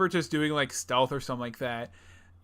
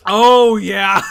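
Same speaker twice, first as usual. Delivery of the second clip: very loud, high, drawn-out cheer; excited agreement; 6 semitones higher, 17 dB louder than usual. Real, not acted. Friend hears a favorite mod named, real excited shout. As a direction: burst out in delighted recognition.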